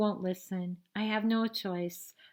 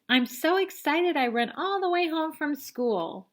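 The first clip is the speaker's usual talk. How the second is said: bright and eager, a bit higher and louder; excited little girl; 7 semitones higher, 4 dB louder than usual. Acted. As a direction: burst in with news from school. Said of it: "And she says".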